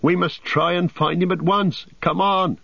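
high, agitated hobbit call; rally them, pitched well up; high and insistent; urgent shout. A narrator's reading. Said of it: "shouted Sam".

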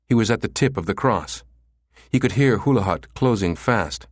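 ordinary delivery, nothing marked

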